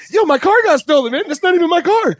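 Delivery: high, loud panicked disbelief; freaking out. Retold or imitated. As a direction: yelp it out like your car just vanished. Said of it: "freaking out".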